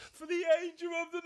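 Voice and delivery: high, quick, theatrical announcement; hammed-up proclamation. Host spelling his Twitter handle in alphabet words, hammed-up high proclamation. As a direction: sing out the name with mock grandeur.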